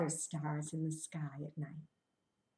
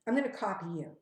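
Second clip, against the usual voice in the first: normal talking voice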